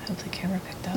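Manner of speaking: excited hushed whisper. Real, not acted. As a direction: whisper it under your breath. After hearing a knock.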